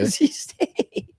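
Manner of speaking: high, slow, laughing through it; laughing repeat. Real, not acted. Repeating the friend's college, laughing high. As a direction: laugh out the words.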